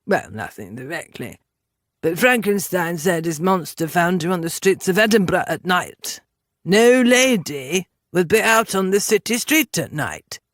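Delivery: haughty gentleman making an offer; lofty, patronising character voice; condescending Englishman. Acted. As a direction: talk down to her as a superior Englishman. Voices the Englishman's disdainful answer.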